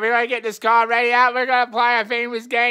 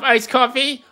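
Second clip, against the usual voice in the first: ordinary talk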